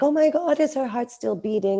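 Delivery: high, sudden alarmed gasp of words; a worried mother hearing the monitor beep; panicked worry. Retold or imitated. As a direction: fear for the baby's heart.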